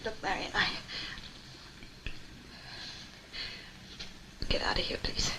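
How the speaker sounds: soft, breathless urgent whisper; hushed plea, afraid; frightened whisper. Acted. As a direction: plead without being overheard. Frightened woman urges him out.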